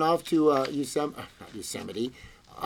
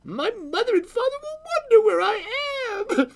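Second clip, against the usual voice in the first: put-on very high child's wail; little girl crying out. A narrator's reading. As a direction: fret aloud as a worried small girl. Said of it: "cried the little girl".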